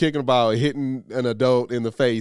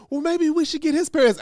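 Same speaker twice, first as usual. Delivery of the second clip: high, prim busybody voice, well above his own; mocking a meddling lady. Stranger lady butting in at his son's race, high mock voice. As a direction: lift into a fussy stranger's sweet meddling tone.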